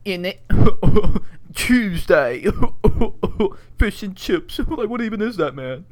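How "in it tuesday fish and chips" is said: mock British accent. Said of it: "an American talking to him and goes".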